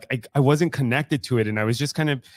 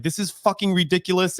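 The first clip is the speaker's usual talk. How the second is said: raised, frustrated yell; retold angry outburst; 8 semitones higher than usual. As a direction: vent at the sky in anger. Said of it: "I was just so angry".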